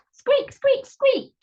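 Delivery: very high, mousey squeaks; squeaking. A narrator's reading. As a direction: squeak it like noisy little shoes. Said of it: "shoes are squeaking like the mouse".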